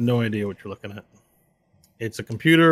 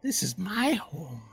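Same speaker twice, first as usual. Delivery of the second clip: high, slow and softer; quiet eerie claim; dark whisper behind you; 8 semitones higher, 4 dB quieter, slower than usual. Acted. Creature voice from darkness.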